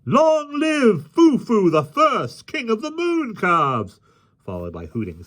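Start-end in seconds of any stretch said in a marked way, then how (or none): 0.0-4.0 high, loud drawn-out mock cheer; roaring shout. A narrator's reading. Whole crew salutes the mock king, roaring high shout. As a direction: roar the mock salute slowly, pitched high. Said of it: "a roaring shout went up".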